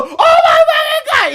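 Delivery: crowd yell. Retold or imitated. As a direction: scream it like the stunned fans in line. Concessions crowd reacting, retold.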